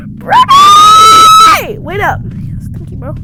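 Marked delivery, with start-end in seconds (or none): 0.1-1.8 high loud yell of a name; calling out. Real, not acted. Gamer yelling for his friend Brody, high loud call. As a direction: shout across the map for him.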